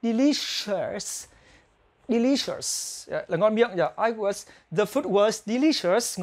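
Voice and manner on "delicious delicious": slow demo. Shown as a demonstration. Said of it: "tra từ điển để có thể phát âm tốt hơn".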